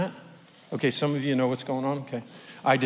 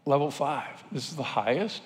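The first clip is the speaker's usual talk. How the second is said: softer, dropping to a hushed disbelief; stunned question; 6 dB quieter than usual. Retold or imitated. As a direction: let the shock drain your voice. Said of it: "And it was like".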